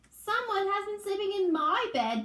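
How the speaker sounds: put-on high, small baby bear voice; wee little voice. Baby Bear finding Daisy in his bed, high wee voice. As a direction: squeak it like a tiny bear cub. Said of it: "Baby Bear said in his wee little voice".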